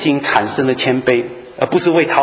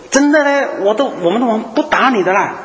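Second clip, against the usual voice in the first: pitched high, eager and insistent, assuring the other side; eager ambassador; 9 semitones higher than usual. Acted. Ambassador assuring the doomed nation there's no war, high and eager. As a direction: push it up high, like you're desperate to be believed.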